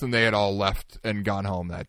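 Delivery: ordinary talk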